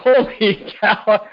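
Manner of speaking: high, drawn-out mock astonishment; hammed-up slow amazement; astonished. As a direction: stretch it out as a retold gasp of disbelief. Retelling his reaction to her age.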